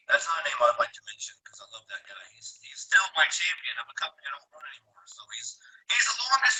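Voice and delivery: fast, hushed whisper; fast confiding whisper. Real, not acted. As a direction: whisper it like a secret. Whispering about a friend's name.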